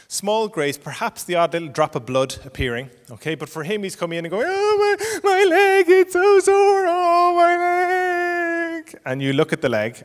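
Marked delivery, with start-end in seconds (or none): 4.5-9.0 put-on high, loud drawn-out child's wail; hammed-up kiddie bawling, pitched way up; wailing hurt four-year-old. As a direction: cry out like a small child with a sore leg. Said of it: "example of a four-year-old child who's gonna come".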